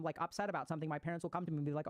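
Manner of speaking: ordinary delivery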